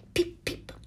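whispered little peeps; barely voiced newborn chick sounds. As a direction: breathe out tiny duckling cheeps. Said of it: "esclamò il piccolo".

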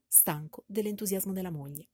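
ordinary delivery

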